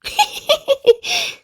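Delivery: very high bubbly giggle. Acted. Little Miss Giggles giggles at the manager. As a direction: giggle helplessly. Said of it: "giggled Little Miss Giggles".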